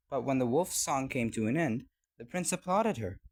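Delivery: plain reading voice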